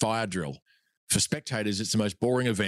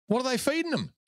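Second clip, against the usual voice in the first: mock astonishment, pitched way up; incredulous; 11 semitones higher than usual. Mock outrage at tall nephews.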